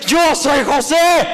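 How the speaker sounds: high, loud, slow declaration; revealing shout. Acted. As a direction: proclaim your identity to your brothers. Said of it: "No entienden". (José revelándose a gritos, lento y agudo)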